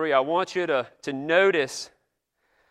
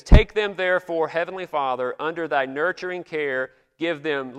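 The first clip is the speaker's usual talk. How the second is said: put-on solemn old prayer; 4 dB louder than usual. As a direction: intone it like an eighteenth-century pastor at prayer. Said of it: "I wish I could go around talking like this today".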